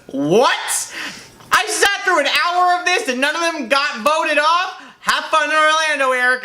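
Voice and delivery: high, exasperated mock outrage; indignant friend. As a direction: complain like a bored, fed-up friend. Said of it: "and she goes".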